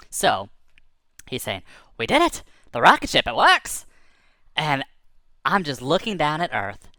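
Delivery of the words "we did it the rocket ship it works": high, delighted exclamation; jubilant, voice leaping up; excited triumph. Acted. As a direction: celebrate a success in bursts. Bird celebrates the working rocket.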